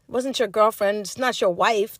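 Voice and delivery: ordinary talk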